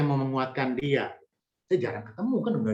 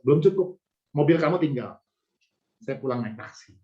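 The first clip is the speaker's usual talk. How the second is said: ordinary talk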